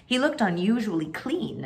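ordinary delivery, nothing marked

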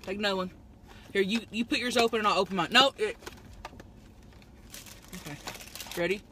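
talking around a cigarette; cigarette in mouth. Real, not acted. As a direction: lips gripping a cigarette. Seen 5: Right-seat speaker holds lit cigarette between lips throughout.

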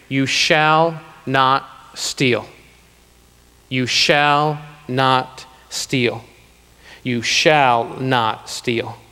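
very slow, word by word; slow, solemn repetition. Acted. Preacher repeating God's commandment to the congregation, slow and weighty. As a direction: let each word stand alone, deliberately spaced.

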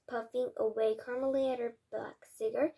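ordinary delivery, nothing marked

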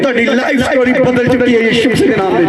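rapid-fire proclamation with hardly a breath; fervent preaching. Real, not acted. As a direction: preach it in a rising, driving rhythm to rouse amens. Preaches declarations at full force. (ਜੋਸ਼ੀਲਾ ਪ੍ਰਚਾਰ)

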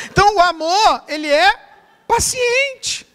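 "paciente" rising high and stretched; stress on paciente. Acted. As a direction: lift "paciente" high and hold it. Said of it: "stresses paciente".

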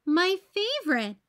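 delighted. A narrator's reading. The last sundae left is the favorite, delighted.

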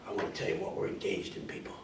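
near whisper, quick and low; hushed aside. Shown as a demonstration. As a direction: drop to a secret hush. Sudden hush after preaching.

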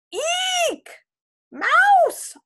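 very high, loud scared shriek; mock fright shriek. Elephant shrieks at the word mouse, mock fright.

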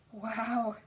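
loud, drawn-out awed wow; declared wow. A narrator's reading. Boy seeing the sprouted baobab, declared wow. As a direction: declare it with impressed amazement. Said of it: "he declared".

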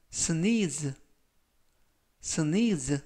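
teacher pronouncing sneeze for students; model pronunciation. Shown as a demonstration. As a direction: sneeze said slowly, ending falling. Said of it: "라고 발음하시면 되겠고요".

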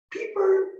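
very high tiny-frog peep; high frog imitation; spring peeper call. Shown as a demonstration. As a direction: pipe it up high. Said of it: "We might have the spring peeper".

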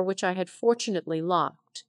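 usual speaking voice

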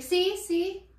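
high, loud gloating "See, see?"; triumphant see. Retold or imitated. Cilantro hater gloating triumphantly, high and loud. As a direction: crow it like you were proven right. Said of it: "everyone who doesn't like cilantro can be like".

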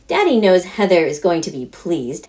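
ordinary delivery

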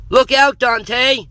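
high, loud yell of alarm; warning shout. A narrator's reading. Narrator yells a warning to Dante at the pothole, shouting. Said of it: "I shouted".

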